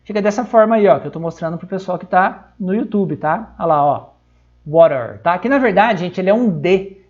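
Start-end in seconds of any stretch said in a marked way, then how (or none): 4.6-5.2 "water" said "wa-rer", American style. Shown as a demonstration. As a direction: tap the T in "water" like a quick R. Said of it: "inglês americano pra essa palavra".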